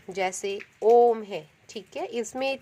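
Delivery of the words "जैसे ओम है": ओम stretched to triple length, louder, falling; drawn-out ओम. Shown as a demonstration. Teacher to pupils, chanting ओम drawn out. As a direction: hold ओम long like a chant. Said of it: "उनको कहते हैं आप प्लुत स्वर".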